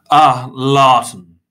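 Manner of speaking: big, loud drawn-out greeting; hearty booming hail. A narrator's reading. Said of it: "Mister Tiny boomed".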